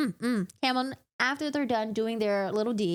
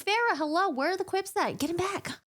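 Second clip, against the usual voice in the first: exasperated nudge; 8 semitones higher than usual. Retold or imitated. Viewer urging Feyre to answer.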